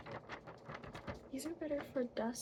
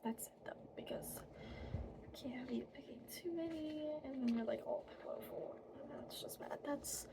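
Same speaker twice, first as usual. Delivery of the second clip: hushed unvoiced whisper; fairy whisper; 7 dB quieter than usual. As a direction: whisper it like a secret.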